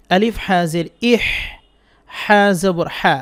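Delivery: slow drawn-out drill of the letter হা; Quran letter drill. Shown as a demonstration. Teacher drilling the class on হা, slow recitation. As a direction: chant each হা syllable clearly for students. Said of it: "আমার সাথে সাথে বলেন".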